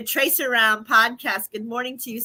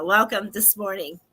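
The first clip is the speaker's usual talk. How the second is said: usual speaking voice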